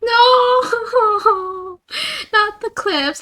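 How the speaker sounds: squealing no, laughing; high, loud laughing plea; high-pitched protest. Real, not acted. Begging chat not clips.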